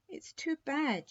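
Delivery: put-on high, slow, dismayed girl's voice; a little girl close to tears over the prince; upset little princess. Princess upset about prince's execution.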